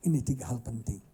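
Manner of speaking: ordinary talk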